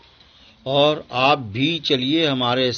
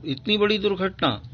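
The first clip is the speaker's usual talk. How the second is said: higher, softer shocked reading; higher, softer dismay at the accident; 6 semitones higher, 3 dB quieter, faster than usual. Shown as a demonstration. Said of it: "ओह".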